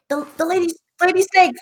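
high, squeaky, panicked blurting; panicked captive. Acted. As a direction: blurt it out in terror. DM voices scared captive.

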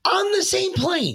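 very high, slow, drawn-out disbelief; incredulous high squeal. Retold or imitated. Co-host mocking the quote a second time, high incredulous squeal. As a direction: squeal each word in mock disbelief.

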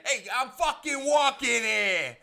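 loud, high mock New York tough guy; tough New Yorker. Munchkin shoving through the crowd, mock New York yell. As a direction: bark it like a cabbie in traffic.

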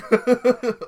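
high loud cackle; loud high laughing fit; loud burst of laughter. Real, not acted. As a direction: let the laugh explode.

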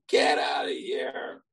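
slow, drawn-out mock shout; acted dismissive yell. Shammai pushing the convert away, drawn-out mock yell. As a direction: stretch out each word as you chase someone off. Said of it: "He pushes him away".

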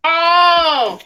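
loud surprised "oh"; surprised shout. Real, not acted. Sudden loud cry of recognition.

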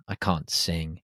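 ordinary talk

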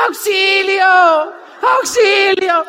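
high, loud, long-drawn mock cry; shouted cry for help. Riders stuck mid-cable calling to the guide, shouted cry for help. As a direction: stretch it out like a stranded rider calling out. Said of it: "comienzan a gritar".

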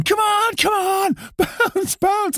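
very high, strained mock shouting; retold hype shout. Frontman urging a still audience to bounce, mock hype shout. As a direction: hype up a dead crowd in a high voice.

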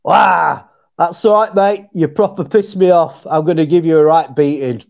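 monotone Northern English threat; understated threat in a Northern English accent; deadpan Northern English delivery. Shown as a demonstration. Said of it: "we're a lot more monotone".

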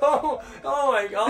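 high, slow, laughing astonishment; real scandalized shock. Real, not acted. Friend reacting to a bathroom confession, scandalized and laughing. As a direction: wail it slowly through laughter.

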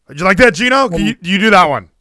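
ordinary talk

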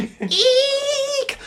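very high, held squeal of brakes; screeching car imitation. Storyteller mimicking a car stopping in the street, high screech. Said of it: "A car screeched to a stop".

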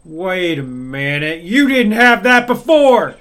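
building to a loud startled outburst; surprised. Acted. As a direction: catch it, then blurt it out loud. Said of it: "Chest is like".

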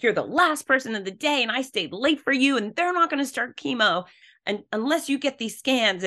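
imitating a nurse scolding; a hint of an angry nurse scolding a patient; mock yelling nurse. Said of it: "now she's yelling at me".

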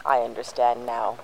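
ordinary delivery

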